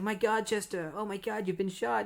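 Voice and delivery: high, shocked and upset; as if she just saw him shot; horrified outcry. Retold or imitated. Connie horrified at the shooting.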